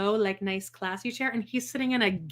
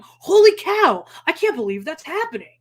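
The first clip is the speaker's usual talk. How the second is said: shocked exclamation; 7 semitones higher, 7 dB louder than usual. Retold or imitated. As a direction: gasp it out in disbelief. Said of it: "I'm going".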